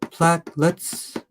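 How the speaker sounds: Platelets said slowly as two syllables, PLATE-lets; slow, deliberate Platelets with no extra vowel; careful two syllables. Shown as a demonstration. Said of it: "Two syllables, not three".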